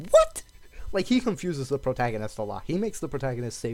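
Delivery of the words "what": sudden very high pitched confused what; high squeaky what. Retold or imitated. Retelling the anime villain, a high baffled squeak. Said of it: "And he just goes".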